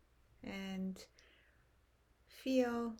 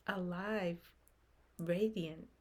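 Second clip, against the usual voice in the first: normal talking voice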